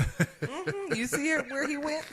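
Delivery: giggling through the words; laughing. Real, not acted. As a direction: laugh while teasing. Laughing at his story.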